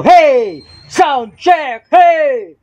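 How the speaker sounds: high, full-voiced mic-test shout. Shown as a demonstration. Pretend singer pushing the mic's level, shouting. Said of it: "or sumisigaw".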